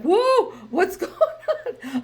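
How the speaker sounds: high, loud, slow alarmed whoa through a laugh; retold shock, high and laughing; startled big sister. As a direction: act out rushing in on a crying kid. Her reaction to crying sister.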